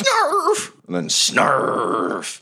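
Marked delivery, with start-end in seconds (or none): 0.0-0.7 short, very high-pitched creature yelp; imitated little beast attacking, quick and squeaky; sudden squealed snarl; high animal snarl. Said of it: "Goblin Junior goes". 1.1-2.4 long, stretched creature growl-word, falling; imitated beast biting, held slowly; one extended snarl noise; drawn-out animal snarl. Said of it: "Goblin Junior goes".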